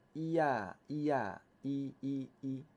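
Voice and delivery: drawn-out model reading for repetition; teacher's reading drill. Shown as a demonstration. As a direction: lead the class in slow, even recitation. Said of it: "咱做伙来念一下".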